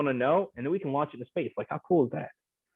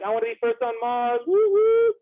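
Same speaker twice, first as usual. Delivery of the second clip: high excited whoop; eager audience cheer; 9 semitones higher than usual. Pretend. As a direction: cheer it like a keen volunteer. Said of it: "being like".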